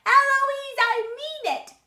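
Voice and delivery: very high, loud mimicking shout; child shouting back. A narrator's reading. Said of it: "And then I shout".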